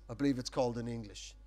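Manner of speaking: ordinary talk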